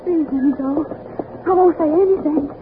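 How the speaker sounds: high-pitched, anxious promise; scared, voice pushed up high; frightened pleading. Acted. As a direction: beg your captor to let you go. Hostage begs to be let go.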